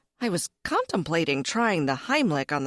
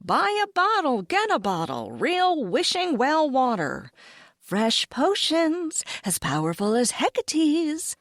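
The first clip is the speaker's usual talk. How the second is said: merchant calling out; 5 semitones higher than usual. Acted. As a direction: call out your wares like a street vendor. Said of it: "merchants called out heralding their wares".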